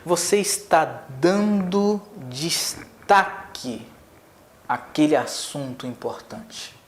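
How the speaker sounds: drawn-out, stretched emphasis on destaque; slow way down to highlight the point. Shown as a demonstration. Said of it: "Quando você varia a entonação da sua voz". (destaque bem arrastado e lento)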